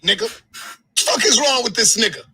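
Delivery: usual speaking voice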